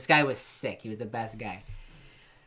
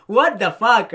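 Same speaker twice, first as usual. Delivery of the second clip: imitated Jamaican yell, high and very loud; outraged Jamaican shout; 8 semitones higher, 13 dB louder, slower than usual. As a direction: holler it in a mock Jamaican accent. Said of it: "he's like, just like".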